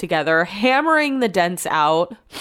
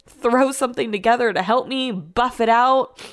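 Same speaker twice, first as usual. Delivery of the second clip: ordinary talk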